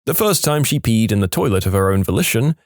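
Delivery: ordinary talk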